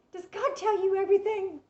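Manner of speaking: high, loud amazed question; astonished question. Retold or imitated. The stunned girl asking about God, astonished. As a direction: blurt it out in disbelief.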